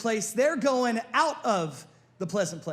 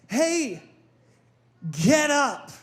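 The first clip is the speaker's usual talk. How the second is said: firm command; 3 semitones higher, 5 dB louder, much slower than usual. Retold or imitated. Jesus commanding dead boy to rise.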